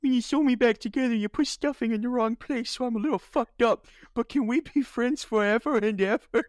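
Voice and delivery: high, squeaky teddy bear voice; Ted impression. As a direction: play a broken, needy stuffed bear. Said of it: "that scene in Ted".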